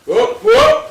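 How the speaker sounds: sudden high, loud yelp of alarm; startled shout. Real, not acted. Man yelps as beer spills over him, high and loud. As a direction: jump and cry out as the beer tips.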